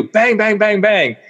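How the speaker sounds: high, punchy sound-effect words; imitated door banging. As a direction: rap out each word like a fist on a door.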